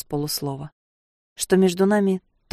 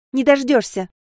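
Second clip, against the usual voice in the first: sharp, loud retort; snapping back; 7 semitones higher, 4 dB louder than usual. Acted. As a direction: snap it back at him. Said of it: "огрызнулась я".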